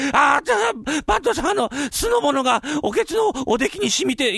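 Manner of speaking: very high, pained wail; stinging pain. Acted. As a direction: squeal to the head clerk through the sting. Vinegar stings the servant's boil.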